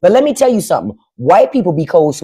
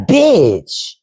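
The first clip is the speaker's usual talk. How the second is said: sassy bitch; 4 semitones higher than usual. Retold or imitated. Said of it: "You be like".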